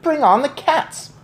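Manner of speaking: high loud rallying cry; boy cheers for cats to his grandmother; excited shout. A narrator's reading. High rallying shout. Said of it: "I shouted".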